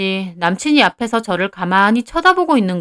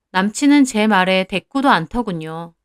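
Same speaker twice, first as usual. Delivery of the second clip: usual speaking voice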